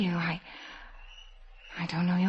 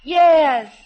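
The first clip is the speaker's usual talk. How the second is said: higher, louder call up to the house; raised answering call; calling back; 10 semitones higher, 12 dB louder than usual. Acted. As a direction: shout up to someone far away. Answering sister calling from cottage.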